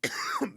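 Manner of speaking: sputtering cough from swallowed water. Real, not acted. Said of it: "water went down the wrong pipe".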